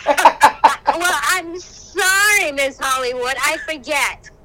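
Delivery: slow, drawn-out teasing sorry; mock sarcastic apology. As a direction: tease her like a diva. Mock apology to actor friend.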